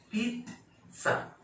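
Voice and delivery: पिज़्ज़ा split high and slow, peet... sa; slow correct pronunciation. Shown as a demonstration. Teacher drilling pizza for learners, slow split demonstration. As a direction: hold peet, pause, sa for पिज़्ज़ा. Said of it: "इसको पढ़ेंगे".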